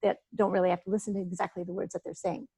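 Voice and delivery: quick, rushed run of words; hurried nervous talker, words tumbling out; talking really fast. Shown as a demonstration. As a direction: rattle it off as fast as you can. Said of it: "If people talk really fast".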